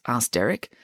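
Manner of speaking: ordinary delivery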